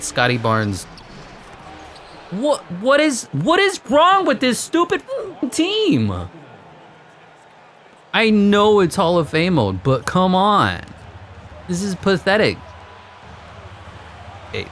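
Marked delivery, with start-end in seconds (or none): 2.3-6.3 very high frustrated yell; exasperated shriek. Real, not acted. Furious at own team. 8.1-12.6 frustrated rant. Real, not acted. Ranting at team.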